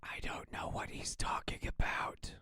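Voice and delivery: hushed, baffled whisper; whispered aside. Real, not acted. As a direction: whisper it under the video. Aside over the playing video.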